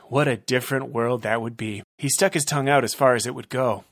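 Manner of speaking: usual speaking voice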